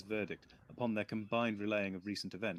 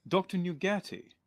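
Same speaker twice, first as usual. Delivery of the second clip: higher, louder eager piping; 9 semitones higher, 6 dB louder than usual. Acted. The doctor pipes up eagerly to Sir Arthur. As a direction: chirp the name up brightly. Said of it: "the doctor piped up".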